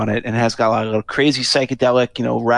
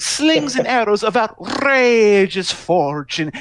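high, slow, grandiose delivery with a laugh in it; mocking Crosby's Shakespeare; 10 semitones higher, slower than usual. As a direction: ham it up like a pompous bard. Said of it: "and he's like".

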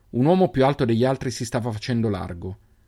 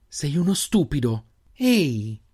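affectionate scolding; 5 semitones higher, slower than usual. A narrator's reading. Sister scolding her brother fondly, high voice. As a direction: tell him off lovingly.

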